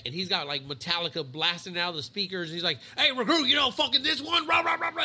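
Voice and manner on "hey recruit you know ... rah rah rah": loud, high mock yell at recruits; imitated drill-instructor yell. Chief yelling at recruits loading bags, imitated shouting. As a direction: bellow it like a chief over blasting music. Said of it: "he yelled at us".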